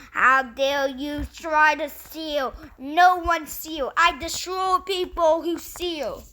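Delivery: menacing a thief, word by word; slow angry threat. Acted. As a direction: spit each word out slowly. Boy threatens the would-be thief.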